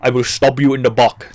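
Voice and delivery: mock Sicilian accent, "back" as "buck". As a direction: put on a broad Sicilian accent. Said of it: "That's how the Sicilians would say it".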